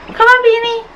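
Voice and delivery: high sing-out to a pet; calling the dog. Real, not acted. Owner calling her dog Beanie, high pet-calling voice.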